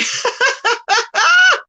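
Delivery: high-pitched peal of laughter; burst of laughter. Real, not acted. Streamer amused by chat's call to fight, laughing hard. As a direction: crack up in a long, high laugh.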